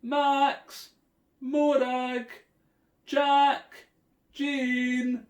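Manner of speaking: high, loud calls of each name; calling names out. A narrator's reading. Parents searching the woods for their children, loud calling. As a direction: shout across the woods for lost kids. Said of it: "the children heard some shouting".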